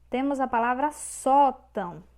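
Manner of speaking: usual speaking voice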